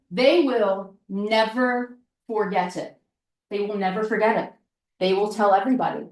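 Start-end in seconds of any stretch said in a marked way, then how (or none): 0.1-3.0 slow, deliberate, word by word; slow emphasis. Shown as a demonstration. Coach stressing how business owners remember you, said slowly. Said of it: "repeated line, slow take". 3.5-4.5 fast, tossed-off repeat; quick repeat. Shown as a demonstration. Coach repeating the point to listeners, fast and brisk. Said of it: "repeated line, fast take".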